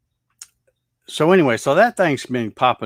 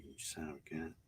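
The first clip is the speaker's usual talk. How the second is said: muttered under the breath, much softer; whispered curse; 6 semitones lower, 24 dB quieter than usual. Real, not acted. Hunting the mosquito by his coffee, softly whispered curse. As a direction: hiss it at the mosquito as he swats.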